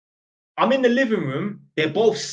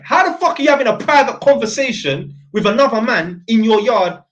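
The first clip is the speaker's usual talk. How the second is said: loud, higher-pitched exasperation; indignant outburst; 4 semitones higher, 7 dB louder than usual. Real, not acted. Electrician appalled at her private conversation with another man, indignant and loud. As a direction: rant it in louder, raised disbelief. Indignant rant at couple's behaviour.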